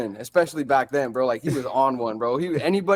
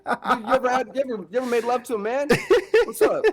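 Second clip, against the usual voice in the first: lightly voiced higher taunting threat; mock taunt; 6 semitones higher than usual. Guest riffing a joking taunt at host. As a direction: tease like a fake tough guy.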